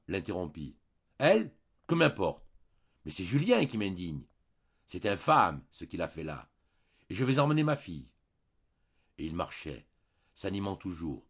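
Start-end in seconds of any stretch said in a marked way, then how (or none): 1.2-8.1 trembling anger. Acted. Baron interrupting the priest, angry outburst. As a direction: flare up in nervous fury. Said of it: "tremblait d'énervement".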